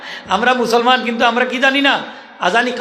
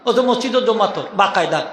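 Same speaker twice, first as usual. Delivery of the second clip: usual speaking voice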